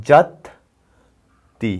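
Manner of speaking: very slow, stretched যাত্রী; drawn out. Shown as a demonstration. Teacher showing how we say বাস যাত্রী, slowly. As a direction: hold যাত্রী long. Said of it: "তাহলে আমরা কিভাবে বলি".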